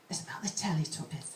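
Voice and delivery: hushed, unvoiced aside; whispering. Real, not acted. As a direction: drop to a whisper. Whispered aside about the poem.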